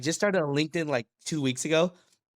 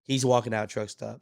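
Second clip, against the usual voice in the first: ordinary talk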